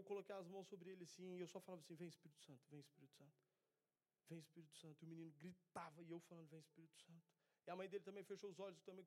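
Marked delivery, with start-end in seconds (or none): 1.9-5.0 hushed, softer repeated invocation; soft, earnest calling on the Spirit; quiet prayer. Retold or imitated. Praying over the screaming boy. 6.5-7.2 hushed, softer repeated invocation; soft, earnest calling on the Spirit; quiet prayer. Retold or imitated. Praying over the screaming boy.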